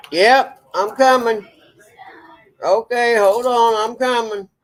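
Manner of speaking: calling out. Real, not acted. Calling out to Dad.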